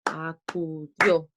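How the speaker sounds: "acudió" slowly beaten out, a-cu-DIÓ; slow syllable-by-syllable "acudió", final one accented; stress on DIÓ. Shown as a demonstration. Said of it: "donde está el golpe de voz".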